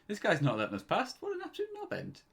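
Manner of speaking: high, mocking outraged call to the crowd; mocking outrage. Mates calling out the bouncer to the queue, mock outrage. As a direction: rope the whole queue in, scandalised.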